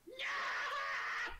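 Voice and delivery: very high, loud panicked shriek; terrified goblin scream. Acted. Goblin fleeing from a terrifying vision, high panicked scream. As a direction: shriek in comic terror, drawn out. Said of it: "looks up to you and goes".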